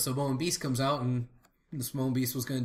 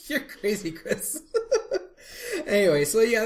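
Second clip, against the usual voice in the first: laughing; 9 semitones higher, 4 dB louder than usual. Real, not acted. Laughing after the joke.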